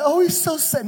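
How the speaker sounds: high-pitched mock sympathy; mock pity. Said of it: "Don't look at me like".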